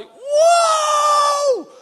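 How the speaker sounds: long high-pitched loud shriek; girly little scream. Retold or imitated. Startled by the fly kick, girly scream. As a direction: let out a comic girly scream. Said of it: "I let out this girly little scream".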